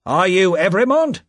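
high, loud, rough official; rough question. A narrator's reading. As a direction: demand it roughly of the prisoner. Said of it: "Another official asked roughly".

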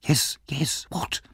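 soft, hurried whisper; urgent whisper. Acted. Missus Corney whispering urgently at the deathbed. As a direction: hush but hurry her.

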